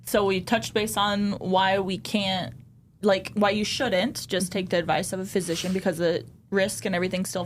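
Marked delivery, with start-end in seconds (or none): none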